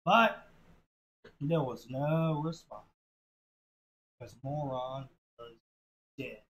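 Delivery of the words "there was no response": ordinary delivery, nothing marked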